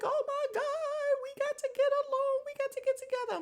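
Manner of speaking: high, frantic pleading voice; frantic cousin. Retold or imitated. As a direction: plead for peace like a panicked cousin. Voicing frantic cousin Winnie.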